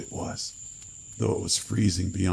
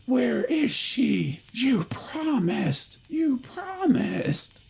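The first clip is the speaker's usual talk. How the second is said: very high, slow shrieking that cracks; screaming, voice cracking; much slower than usual. A narrator's reading. Cadaver screaming promises at Lloyd, cracking shriek. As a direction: scream until the voice breaks. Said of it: "Its voice cracked on the last syllable".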